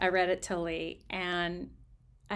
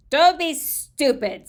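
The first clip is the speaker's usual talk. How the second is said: scornful brother; 9 semitones higher, 14 dB louder, slower than usual. A narrator's reading. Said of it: "said my brother".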